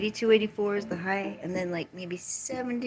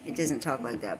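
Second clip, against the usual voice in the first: ordinary talk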